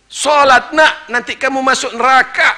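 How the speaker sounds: high loud scolding parent voice; mock nagging parent. Preacher mimicking parents nagging their child, loud and high. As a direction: scold the child in a shrill mocking nag.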